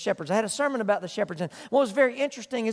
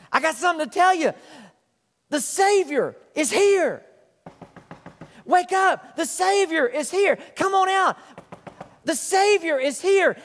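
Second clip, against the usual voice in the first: higher and louder, calling out "Wake up!"; excited shouting; 6 semitones higher, 5 dB louder than usual. Acted. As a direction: rouse the sleeping town with the good news. Shepherds waking the town.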